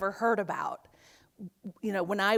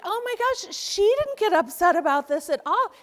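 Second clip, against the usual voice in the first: much higher, astonished inner exclamation; amazed; 9 semitones higher, 7 dB louder than usual. Retold or imitated. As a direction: struck with admiration. Amazed at friend's calm.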